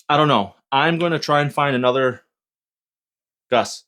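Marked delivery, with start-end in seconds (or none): none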